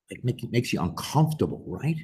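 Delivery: plain reading voice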